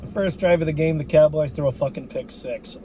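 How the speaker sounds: usual speaking voice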